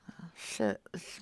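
soft, breathy under-breath curse; muttered under breath. Real, not acted. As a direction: drop it to a private curse. Caught in prank, under breath.

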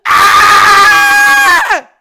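very loud, high-pitched scream; sudden hammed-up shriek of fright; mock scream. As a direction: scream it at full volume. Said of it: "I just go".

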